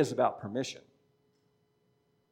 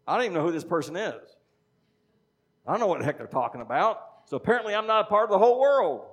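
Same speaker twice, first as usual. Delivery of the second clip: building to a fast, louder, higher exasperated scoff; exasperated retort; 5 semitones higher, 5 dB louder, faster than usual. Retold or imitated. Preacher scoffing at celebrity news hype, exasperated and rising. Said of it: "I'll go".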